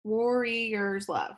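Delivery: Warrior's Love spoken very slowly, each syllable apart; counting beats. Shown as a demonstration. Teacher showing the class its four beats, slowly. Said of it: "that's four beats".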